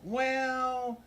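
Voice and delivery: high, drawn-out stalling "well"; evasive excuse. Retold or imitated. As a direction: imitate someone stalling for an excuse. Insurer making excuses under questioning.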